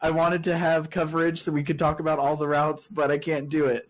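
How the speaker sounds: high, laughing backpedal; laughing protest. Retold or imitated. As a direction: cave in while laughing. Retelling own backing out, laughing.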